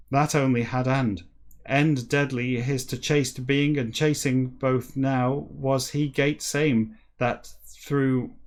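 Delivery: slow, deliberate read-aloud; story narration. Acted. Reader reciting a backwards whale novel to listeners, measured narration. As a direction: read each phrase out with measured emphasis.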